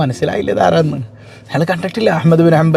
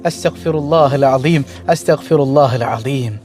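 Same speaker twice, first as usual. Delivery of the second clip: slow, lower, reverent recitation; slow devout prayer; 5 semitones lower, slower than usual. Shown as a demonstration. Preacher showing the congregation how to repent, slow and reverent. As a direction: draw out each word in devotion.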